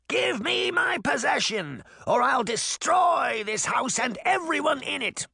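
very high witch's shriek; screamed threat. A narrator's reading. Witch demanding her stolen possession back, screamed. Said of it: "Baba Yaga screamed".

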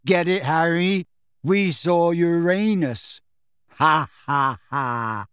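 slow, stretched-out punchline with forced ha-has; giggling feebly. A narrator's reading. Dazed boy repeats his joke, giddy and insistent. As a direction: hammer the silly joke home, drawing out Uranus. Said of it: "still giggling feebly".

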